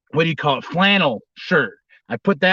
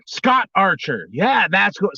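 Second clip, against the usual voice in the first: ordinary talk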